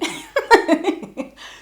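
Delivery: bursting into a high laugh; a real, high giggle. Real, not acted. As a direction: laugh at your own mess. Laughing at own story.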